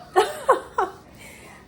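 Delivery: a sudden high laugh; high burst of laughter. Real, not acted. Showing her bump to viewers, high laugh.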